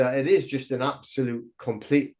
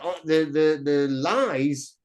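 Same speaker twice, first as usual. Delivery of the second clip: normal talking voice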